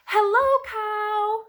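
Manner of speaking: high, loud, drawn-out greeting; Duck calling hello. A narrator's reading. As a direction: call out as a storybook duck. Duck greets Cow in story.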